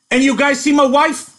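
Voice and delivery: high, loud, demanding shout. A narrator's reading. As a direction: bark it out like a jealous husband hunting his wife. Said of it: "he demanded".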